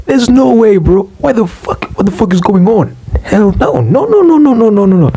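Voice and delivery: high-pitched mock outrage; outraged disbelief. Passenger refusing greedy boda boda riders, outraged. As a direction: refuse in a rising, frantic squeal of no's.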